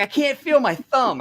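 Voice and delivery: slow, high-pitched retold complaint; mock aggrieved wail. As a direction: imitate a hurt friend wailing, drawn out. Retold injured friend's wail.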